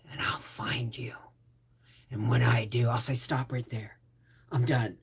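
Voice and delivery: low, whispered menacing promise; Liam Neeson threat. Retold or imitated. Fan imagining Liam Neeson threatening her, low menacing whisper. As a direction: breathe it low and dangerous. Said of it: "talk to me in that voice".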